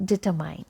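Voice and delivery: determine as di-ter-MINE; mispronunciation. Retold or imitated. Teacher imitates a common error, mispronounced determine. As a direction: end "determine" like the word mine. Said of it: "people pronounce it like".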